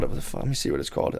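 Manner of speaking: under the breath, quick and unvoiced; muttered aside. Real, not acted. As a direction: mutter to yourself while searching. Muttering aside while searching for title.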